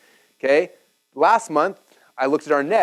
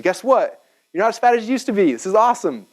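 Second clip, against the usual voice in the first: higher-pitched, upbeat retold coach; excited good news; 8 semitones higher than usual. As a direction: announce progress with delight. Retold coach reporting good progress.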